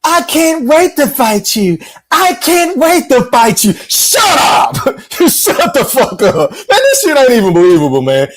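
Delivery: high, slow mock whine; mocking imitation. Host ridiculing a fighter's "believable" trash talk, high mocking imitation. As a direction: drag it out high, making fun of the fighter.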